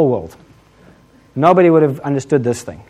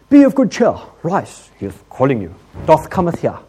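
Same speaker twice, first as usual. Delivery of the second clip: over-grand churchy summons, dropping after the opening; mock-religious disciples; 2 semitones higher, 3 dB louder than usual. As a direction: proclaim it in mock King James piety. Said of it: "they swing right to religious".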